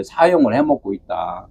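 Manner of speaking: soft low repeat of 사용을 해먹고 있다; quiet echo. Shown as a demonstration. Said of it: "사용을 해먹고 있다 이거예요".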